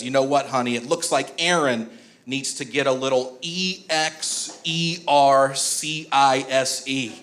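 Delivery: mom spelling EXERCISE letter by letter, slowly; slow spelled-out E X E R C I S E; spelling out loud. Retold or imitated. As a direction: spell it slowly like hiding it from a toddler. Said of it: "Mom looks at my dad and says".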